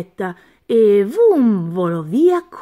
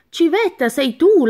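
higher and louder, a bright hello; cheerful greeting; 7 semitones higher, 4 dB louder than usual. Acted. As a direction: call out to her happily. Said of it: "la salutò il topo".

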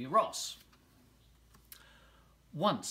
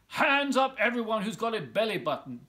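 loud, high lion's roar; lion calling every animal, loud and high; 8 semitones higher, 7 dB louder than usual. Acted. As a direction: bellow it out to the whole jungle. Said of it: "roared the lion".

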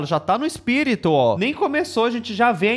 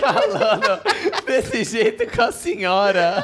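laughing imitation; slower than usual. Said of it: "repeats her line laughing".

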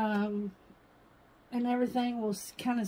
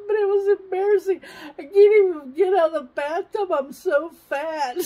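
high, loud, tearful protest; 7 semitones higher, 10 dB louder than usual. Retold or imitated. Answering her husband's comfort, loud and tearful. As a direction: wail it out, embarrassed.

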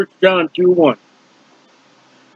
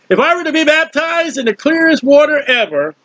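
normal talking voice

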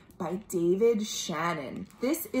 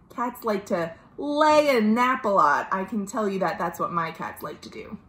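ordinary delivery, nothing marked

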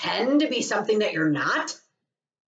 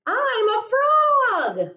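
high, loud and slow, a big proud reveal; excited announcement; 11 semitones higher, 5 dB louder, slower than usual. Acted. Piggie proudly tells Gerald she is a frog, high and loud. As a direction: shout it out with delight, drawn out.

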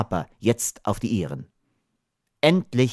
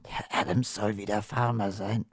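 very soft, moved voice; hushed, touched musing; moved murmur; 8 dB quieter than usual. A narrator's reading. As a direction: say it quietly, overcome. Theo moved, wiping his eyes.

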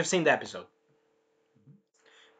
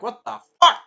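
shocked vampires; 7 semitones higher, 8 dB louder than usual. Retold or imitated. Room of vampires reacts.

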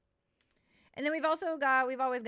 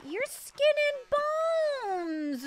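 much higher, louder, slowly stretched wail; dismayed cry; 13 semitones higher, 7 dB louder, much slower than usual. Real, not acted. Player wails at his starving horse in dismay.

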